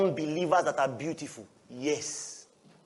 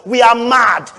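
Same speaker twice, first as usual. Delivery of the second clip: loud, higher repeated cry; preaching shout; 4 semitones higher, 8 dB louder, slower than usual. Real, not acted. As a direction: hammer it home to the church.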